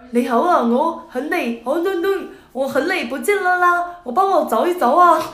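hammed-up Cantonese chatter; mock Cantonese. Imitating the man speaking Cantonese to airport staff. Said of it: "用了一口流利的粤语在讲".

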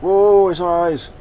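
slow, loud, high whoa; awed whoa. Real, not acted. Gamer gaping at the creature's many eyes, slow and loud. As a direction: stretch it out in amazement.

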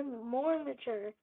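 slow, stretched mock-serious words; drawn-out mature. Real, not acted. Streamer promising cleaner videos, said slowly in mock earnest. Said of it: "I wanna make my videos more".

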